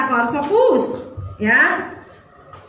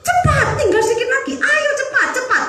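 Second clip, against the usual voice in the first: much higher, urgent calls to run faster; cheering yell; 13 semitones higher than usual. Retold or imitated. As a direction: urge the runner on from the stands. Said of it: "Saya teriak".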